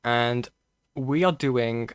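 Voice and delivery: ordinary talk, nothing marked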